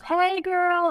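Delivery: high, sing-out mock call; imitated holler across the store aisles; retold yell. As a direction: call out playfully without real volume. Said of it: "Todd yelled".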